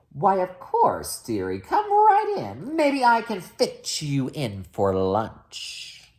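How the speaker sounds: slow, sweet, creepy witch voice; slow creepy hungry witch; sinister witch. Acted. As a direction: lure him in slowly, sing-song. Hungry witch luring prince.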